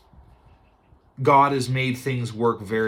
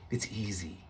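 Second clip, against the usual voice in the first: much softer, lower repeat; soft low echo; 4 semitones lower, 13 dB quieter than usual. Shown as a demonstration. Servant softly coaxing Naaman, low and hushed. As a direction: whisper it back down.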